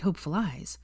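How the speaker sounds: usual speaking voice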